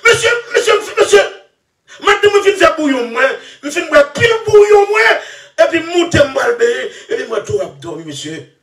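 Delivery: high, whining plea of a woman, voiced lightly; mock pleading woman. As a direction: beg monsieur in a high crying voice. Mocking woman pleading, called madame. (vwa fanm k ap plenn, byen wo)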